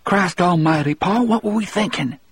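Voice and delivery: high, frightened little hiss; hissy little voice. A narrator's reading. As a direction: squeeze out a scared, high-pitched protest. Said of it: "in a hissy little voice".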